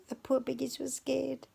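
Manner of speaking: usual speaking voice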